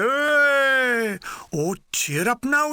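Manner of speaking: high, jolly, falsely bright; jolly the groaning lads along; mock cheery. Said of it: "he says in a mock cheery voice".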